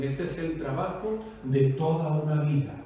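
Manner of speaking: low, soft quoted lament; subdued retold lament. Preacher quoting people whose property burned, subdued lament. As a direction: drop low and quiet, as if in loss. Quotes fire victims who lost everything.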